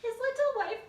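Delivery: very high, loud wail; high hammed-up freak out; vocal freak out. Shown as a demonstration. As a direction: screech the words in mock horror. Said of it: "you're gonna have a vocal freak out".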